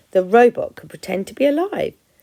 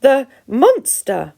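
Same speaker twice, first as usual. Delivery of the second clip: gasped; 4 semitones higher, 7 dB louder, much slower than usual. Acted. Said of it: "the opossum gasped".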